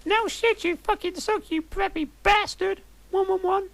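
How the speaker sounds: very high squeaky mock insult; Willow voice. Willow insulting Draco in a very high squeaky voice. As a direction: screech it like a cartoon teen. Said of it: "said Willow".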